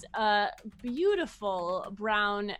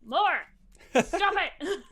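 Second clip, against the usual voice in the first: much higher, louder yelling at the cow; scolding the cow; 8 semitones higher, 6 dB louder, slower than usual. Acted. Farmer yelling at his cow in front of everyone. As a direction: shout down a misbehaving animal. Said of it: "cow interrupts".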